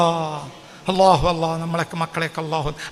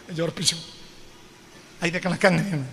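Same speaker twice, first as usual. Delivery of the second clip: normal talking voice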